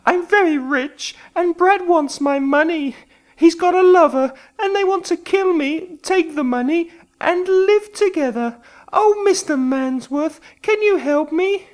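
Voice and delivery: high anxious woman's voice; frightened woman. A narrator's reading. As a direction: plead with the detective for your life. Simone pleading with the detective.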